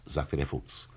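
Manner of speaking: plain reading voice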